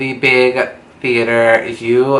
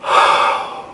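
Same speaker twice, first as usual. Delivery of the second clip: sharp breathy gasp of shock. Retold or imitated. Seeing porn on the big couch, a shocked gasp. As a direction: suck in air in horror.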